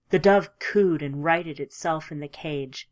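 ordinary delivery, nothing marked

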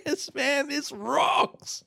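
high-pitched glee; delighted. Acted. Third guy gushes about his new legs in a high voice. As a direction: gush with joy.

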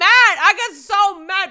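loud, high exasperated shout; exasperated outburst. Real, not acted. She's telling viewers how mad curse words make her, shouting. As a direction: cry out in frustration.

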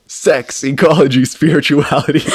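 real laughter taking over; breaking into laughter. Real, not acted. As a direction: struggle to get the title out through laughter. Cracking up at the book title.

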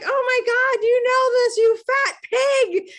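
high, loud self-insult, dragged out; horrified outburst. Retold or imitated. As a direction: scold yourself in disgust. Shocked at her own insult.

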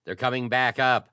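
loud, high urgent shout; urgent battle shout. Acted. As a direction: yell it to hurry him. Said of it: "shouted Alden".

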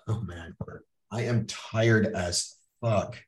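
low, slow tired groan of words; weary complaint. Acted. Speaker mimics coming home tired, weary. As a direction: drag it out, worn out and low. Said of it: "did you come home and go".

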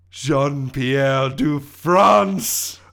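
loud, very drawn-out French name announcement; slow, booming French flourish; French villain reveal. Acted. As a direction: announce yourself grandly with a French accent. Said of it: "it is revealed to be".

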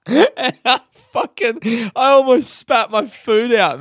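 high, loud, slow words squeezed out through laughs; talking through laughter. Real, not acted. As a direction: barely get it out for laughing. Laughing as she tells punchline.

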